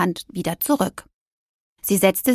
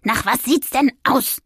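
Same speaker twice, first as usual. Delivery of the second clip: higher-pitched, irritated comeback; snappish retort; 4 semitones higher than usual. A narrator's reading. Injured witch to her cat, snapping back. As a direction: snap back at a silly question. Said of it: "blaffte Aurelia ihn an".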